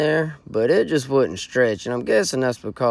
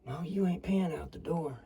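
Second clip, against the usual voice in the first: hushed scolding whisper; 5 semitones higher, 12 dB quieter than usual. Acted. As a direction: hiss it under your breath. Whispered argument in blind.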